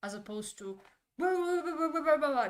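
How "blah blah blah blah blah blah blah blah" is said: loud, high run of blahs; high-pitched mock shouting; mock yelling. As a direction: yell it out as one undifferentiated rant. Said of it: "it's just yelling when it should be".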